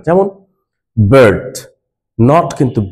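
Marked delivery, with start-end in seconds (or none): 1.0-1.5 বার্ড with a brief, light vowel before the R; short light vowel. Shown as a demonstration. Teacher models R-controlled বার্ড, short vowel. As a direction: clip the vowel in বার্ড, straight into R. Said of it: "একদমই হালকা".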